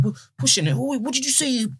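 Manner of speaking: high, mocking tough-guy challenge; mock aggressive clubber. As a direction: bark it like a drunk picking a fight. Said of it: "whole load of talking".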